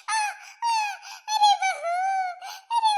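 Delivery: high mock groans collapsing into laughter; mocking mother-in-law's moans. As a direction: mimic an old in-law's wails, then crack up. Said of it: "सासू मैया कर कैसे रही है". (सास के कराहने की हँसते हुए नकल)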